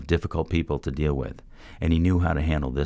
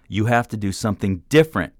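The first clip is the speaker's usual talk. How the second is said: loud belted order, higher pitch; 7 semitones higher, 4 dB louder than usual. A narrator's reading. Mentor belting at the struggling man, loud. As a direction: belt it right at him. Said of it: "Sai belted at him".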